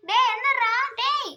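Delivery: mother shouting to wake her sleeping son; urgent calling. Acted. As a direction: bark his name to rouse him.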